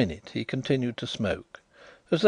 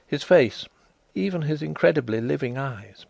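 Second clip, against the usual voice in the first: ordinary delivery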